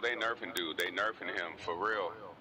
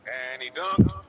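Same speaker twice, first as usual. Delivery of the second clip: loud hyped celebration; excited shout; 3 semitones higher, 10 dB louder than usual. Real, not acted. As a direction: shout it out in triumph. Celebrating own dunk.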